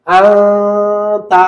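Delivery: আনতা with the n hidden in a long nasal hum; আনতা slowly, n blurred into a drawn-out ghunnah; ikhfa pronunciation. Shown as a demonstration. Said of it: "এখন এটাকে আমরা বলবো".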